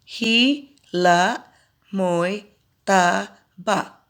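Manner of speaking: Hila mo'y tabak broken into counted beats; counting syllables. Shown as a demonstration. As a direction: pause between each word to count. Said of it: "line one".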